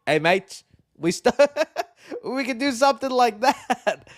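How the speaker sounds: put-on a light, laughing Australian ape accent; mock Australian accent. Imagining Australian apes, in a half-laughed mock Australian accent. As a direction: toss off a quick Aussie accent, breaking into a laugh. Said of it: "Australian apes would be cool too".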